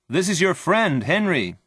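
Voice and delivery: high-pitched call through a door; friend calling out. A narrator's reading. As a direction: call out to be let in. Friend calls from outside the door.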